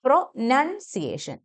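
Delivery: Pronunciation as "pro-NUN-see-ay-shun", syllables separated; NUN sound. Shown as a demonstration. Teacher models the word, short second vowel. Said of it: "നൺ sound ആണ്".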